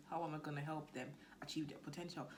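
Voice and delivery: normal talking voice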